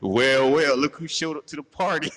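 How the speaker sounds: loud sarcastic hosting voice; mock greeting. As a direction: greet him like a smug host. Mocking imagined greeting to intruder.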